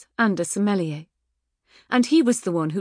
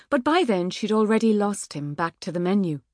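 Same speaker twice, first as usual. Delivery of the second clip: plain reading voice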